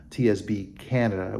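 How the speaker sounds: ordinary delivery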